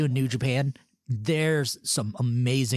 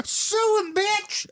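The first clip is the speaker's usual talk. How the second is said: mock yell; much slower than usual. Mock courtroom outburst.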